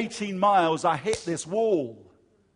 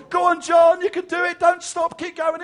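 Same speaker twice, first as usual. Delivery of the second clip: wife cheering; 11 semitones higher, 8 dB louder than usual. Retold or imitated. Wife running alongside cheering.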